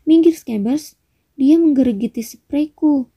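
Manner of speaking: high, exclaimed annoyance; irritated scolding. A narrator's reading. Ron shooing his rat off the bed, irritated.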